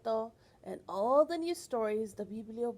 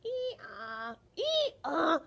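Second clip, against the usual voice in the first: donkey bray; 5 semitones higher, 3 dB louder than usual. Retold or imitated.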